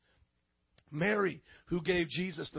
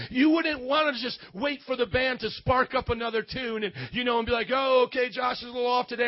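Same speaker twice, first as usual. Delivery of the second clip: loud, raised, driving preacher's voice; fired-up preaching; 5 semitones higher, 5 dB louder than usual. Real, not acted. Preacher urging the church to drink and worship, fired-up preaching. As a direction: preach it with rising fervor.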